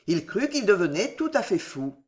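ordinary delivery, nothing marked

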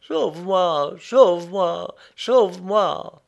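put-on cling and beg like a frightened child; sobbing plea. A narrator's reading. Prisoner clinging to the narrator, sobbing plea. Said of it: "et il sanglotait".

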